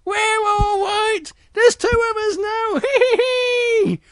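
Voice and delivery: very high squeaky cub voices, giggling; two little bears. Acted. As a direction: squeal it gleefully with a hee-hee. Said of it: "little bears jump up and go".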